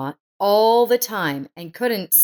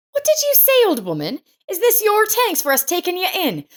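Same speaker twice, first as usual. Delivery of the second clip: furious retort; 9 semitones higher than usual. Acted. Laura turns on Miranda after her reproach, furious. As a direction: fire back at the old woman in outrage.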